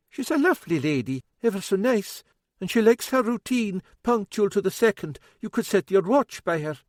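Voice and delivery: fussing, a wee bit worried cook; older woman, worried. A narrator's reading.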